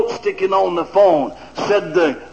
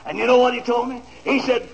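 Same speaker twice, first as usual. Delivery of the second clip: normal talking voice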